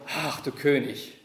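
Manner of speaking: slow mock homage; a slow sneer posing as a bow. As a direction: soldiers mocking Jesus, slow and sneering. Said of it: "Das war blanke Ironie".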